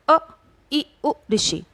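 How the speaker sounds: short vowels said crisply one by one; short vowels demo. Shown as a demonstration. Teacher asking students to say short vowels, crisp demo. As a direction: show how quick each vowel is. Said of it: "जैसे अब आप बोल के देखिए".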